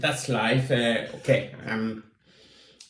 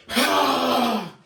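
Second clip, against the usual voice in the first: exertion groan; 10 semitones higher than usual. Real, not acted. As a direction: let out a strained cry. Finishing exercise, exertion cry.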